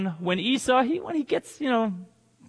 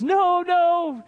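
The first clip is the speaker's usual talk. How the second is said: high, drawn-out acted dismay; mock-distressed, pleading denial; confused, sad protest; 9 semitones higher, slower than usual. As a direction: protest in a raised pitch, playing the wronged son. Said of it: "he's very confused, and it's really sad".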